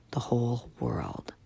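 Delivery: soft furtive whisper. Acted. Tank whispering to the kids, secret whisper. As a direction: keep it hushed.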